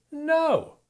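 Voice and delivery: high, loud cry of protest; shouting no. A narrator's reading. Peter shouting at Jesus, high loud protest. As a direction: fling out a sharp no. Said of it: "Peter shouts at Jesus".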